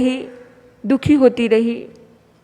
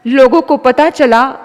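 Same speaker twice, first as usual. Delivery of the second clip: usual speaking voice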